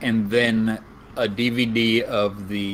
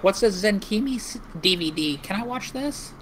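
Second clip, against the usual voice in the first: mum voice; 10 semitones higher, 4 dB quieter than usual. Retold or imitated. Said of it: "your mom gonna be like".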